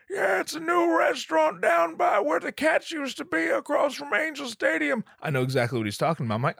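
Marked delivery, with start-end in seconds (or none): 0.1-5.0 put-on high, thin old man voice, then a shout; hammed-up high old man shouting. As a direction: squeak it out like a frail old guy.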